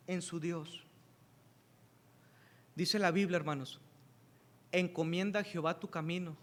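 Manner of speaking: soft, tender sermon close; hushed preaching. Acted. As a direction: bring the room down to a near hush. Quiet intimate preaching.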